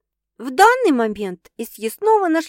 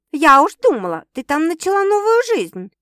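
a light insinuating tease, higher and louder; hint at more than you say; with a double meaning; 8 semitones higher, 7 dB louder than usual. A narrator's reading. Sister teasing Sasha about a new life, mildly insinuating. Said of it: "явно с двойным подтекстом произнесла".

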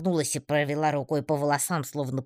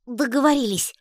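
высоко и громко, ликуя; выкрикни радостно тонким голоском; радостный возглас; 10 semitones higher, 6 dB louder than usual. A narrator's reading. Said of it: "Гишка радостно заплясал". (Гишка радуется согласию Татьяны: громкий ликующий писк)

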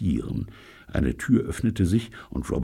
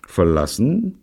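grumpy, rising question; louder, higher disgruntled echo; sullen; 6 semitones higher, 5 dB louder, slower than usual. A narrator's reading. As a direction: ask it sulkily, put out. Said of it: "Fragte Neil verdrossen".

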